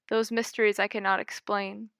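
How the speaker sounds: ordinary delivery, nothing marked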